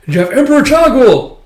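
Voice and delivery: high, loud excited reveal; hype announcement. Real, not acted. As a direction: announce him like a big reveal. Hyping an entrance.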